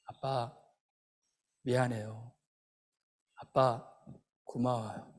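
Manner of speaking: low, soft, halting apology; meek child's words. Retold or imitated. Injured son to his dad in the car, meek and quiet. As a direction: say it small and low, with gaps, imitating the boy. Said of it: "아이가 딱 두 마디 하더래요".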